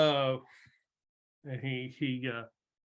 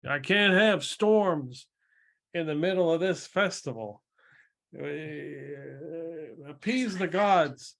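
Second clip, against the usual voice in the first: exasperated mayor; 3 semitones higher than usual. Acted. Mayor demands the gods be appeased, exasperated command. Mayor frets over festival storms.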